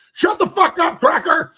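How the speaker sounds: high-pitched, loud outraged yell; yelling at someone. Acted.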